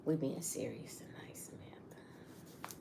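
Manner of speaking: hushed teasing whisper to her co-host; mock serious whisper. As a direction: whisper it like a dramatic secret. Teasing co-host about serious face.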